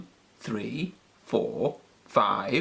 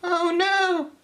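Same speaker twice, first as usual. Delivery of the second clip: much higher little bunny cry. A narrator's reading. Bunnies turn Peppy away, very high voice. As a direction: squeal it like a bunny. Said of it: "they cried".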